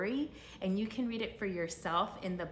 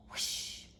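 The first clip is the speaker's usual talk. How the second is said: whoosh sound. Acted. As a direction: blow out a quick whooshing breath. Second angel arrives.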